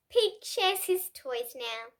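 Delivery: usual speaking voice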